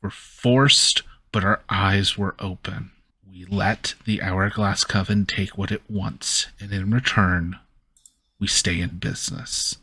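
ordinary talk, nothing marked

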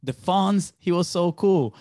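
mock awe at the Fonz; playing fans gushing over the Fonz; impressed fan. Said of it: "they're like".

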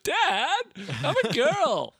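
put-on high child's protest. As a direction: squeak like an upset little girl.

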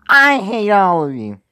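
slow, loud, high-pitched yell. Acted. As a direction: belt it out at full volume. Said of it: "so I can yell as fucking loud".

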